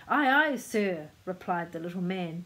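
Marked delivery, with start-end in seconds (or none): 0.1-1.1 loud, slow, dutiful acknowledgement; smart reply. A narrator's reading. New cabin boy to the captain, replying smartly. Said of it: "just as smartly".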